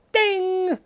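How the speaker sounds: high sung ding; mock game show ding; ding chime. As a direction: imitate the correct-answer bell.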